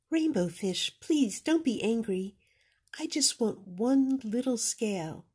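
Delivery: timid little fish. Acted. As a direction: ask shyly.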